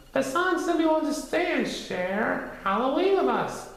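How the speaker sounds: put-on high boyish Christopher Robin voice; young Christopher Robin explaining. A narrator's reading.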